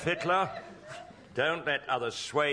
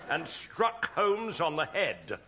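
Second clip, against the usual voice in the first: normal talking voice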